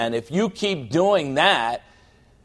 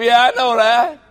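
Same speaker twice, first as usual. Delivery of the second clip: pleading freak-out; 8 semitones higher, 5 dB louder than usual. Retold or imitated. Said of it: "I say".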